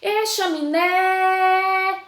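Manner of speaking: high calling voice, long held "chaminé" on its last syllable; sing-song hail, "chaminé" stretched at the end; calling the word. Shown as a demonstration. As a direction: call out "chaminé" slowly, holding the final "né". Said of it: "Eu vou chamar a palavra chaminé".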